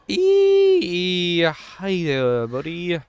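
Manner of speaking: high squeal, then cooing. Real, not acted. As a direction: coo at a cute little creature. Greets baby creatures cutely.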